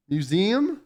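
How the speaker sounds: "Museum" as myoo-ZEE-um with rising tune; questioning standard pronunciation. Retold or imitated. Streamer querying friend's pronunciation, "Museum" said myoo-ZEE-um, rising. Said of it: "You say it like that?".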